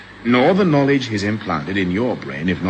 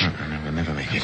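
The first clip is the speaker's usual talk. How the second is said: softer, fast anxious murmur; hushed worry; 5 semitones lower, 8 dB quieter, faster than usual. Acted. Holly to his friend, hushed while planning escape. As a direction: keep it down so the guard won't hear. Fugitives whisper near the guard.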